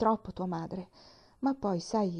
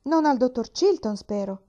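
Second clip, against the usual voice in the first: louder, sharp alarmed outburst; old man startled at the doctor's name; alarmed cry; 4 semitones higher, 6 dB louder than usual. A narrator's reading. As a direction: jolt it out in alarm. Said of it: "Gridò John Pendleton".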